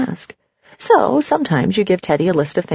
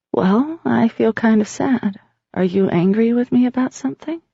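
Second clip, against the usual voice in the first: pained; 3 semitones higher than usual. A narrator's reading. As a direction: sound sad and wounded. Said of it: "In a pained way".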